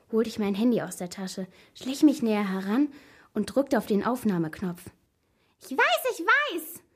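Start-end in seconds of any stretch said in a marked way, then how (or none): 5.6-6.8 very high squeak; squeaky voice. A narrator's reading. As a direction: squeak it far above your usual pitch. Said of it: "Diese piepsige Stimme". (sehr hohe, piepsige Stimme)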